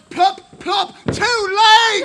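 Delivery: very loud, high shouting of the reveal; excited shout. Real, not acted. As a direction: bellow it out, overjoyed. Reveal of two legs.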